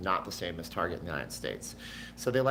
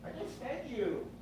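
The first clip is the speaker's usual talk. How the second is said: pet voice; 14 semitones higher, 7 dB quieter than usual. Real, not acted. Scolding the cat.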